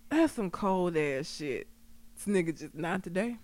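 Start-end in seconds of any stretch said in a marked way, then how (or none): 2.7-3.4 soft, straight-faced imitation; deadpan. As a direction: say it quietly, without any feeling. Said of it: "Straight face".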